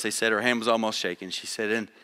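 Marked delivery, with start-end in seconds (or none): 1.7-1.9 hushed, halting nervous reading; a timid first-timer stumbling through; quiet and hesitant, low and soft. Retold or imitated. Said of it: "She was really nervous".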